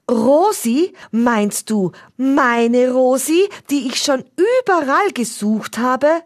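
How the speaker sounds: light up at the name; excited uncle. A narrator's reading. Uncle excited about Rosi.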